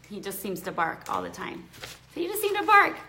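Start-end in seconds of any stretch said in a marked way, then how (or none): 2.1-3.0 mock-chiding pet voice; talking to the dog. Real, not acted. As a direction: tease the puppy fondly.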